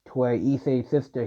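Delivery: ordinary delivery, nothing marked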